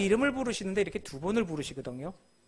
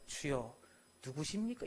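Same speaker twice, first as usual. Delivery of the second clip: soft and humble, a quiet timid question; bow low and ask gently; meek, humble ask; 4 semitones lower, 9 dB quieter than usual. Shown as a demonstration. Saul asking the voice meekly, as we imagine it, soft and humble. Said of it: "이렇게 하겠습니까?".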